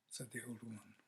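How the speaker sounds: ordinary delivery, nothing marked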